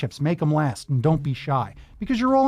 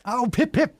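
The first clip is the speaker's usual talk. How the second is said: higher-pitched playful British interjection; mock British; 6 semitones higher than usual. Host joining the accent game, mock British. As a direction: toss in a jolly Brit catchphrase.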